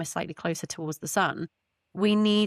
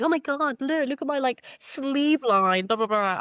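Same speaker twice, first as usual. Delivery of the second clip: excited mock quote; 7 semitones higher than usual. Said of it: "if everyone starts comparing, like".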